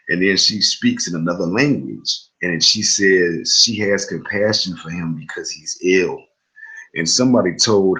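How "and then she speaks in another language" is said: normal talking voice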